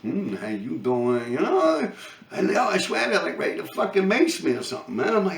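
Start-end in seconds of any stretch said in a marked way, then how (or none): 0.0-1.3 low, soft, slow flirting croon; smooth pickup line. Retold or imitated. Approaching women on the street with a low slow pickup line. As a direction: lay on the charm slowly. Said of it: "I'm looking at them, like".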